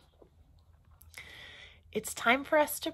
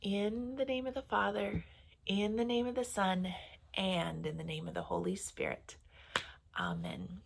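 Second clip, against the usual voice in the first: put-on gentle children's prayer; 7 dB quieter than usual. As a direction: lead little ones in prayer, pausing to echo. Closing blessing prayer.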